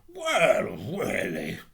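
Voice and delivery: loud, indignant outburst dropping in pitch; affronted miller. Acted. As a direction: take loud, pompous offence.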